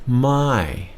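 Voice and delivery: My said high with a falling tone. Shown as a demonstration. Teacher showing the falling tone of the word for no. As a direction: drop the pitch sharply on My. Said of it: "falling tone on that to mean no".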